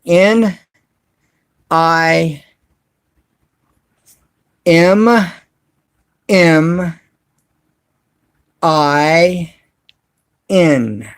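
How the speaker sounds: slow, letter by letter with long pauses; deliberate slow dictation; spelled out slowly. Shown as a demonstration. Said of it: "I'll say it real slow".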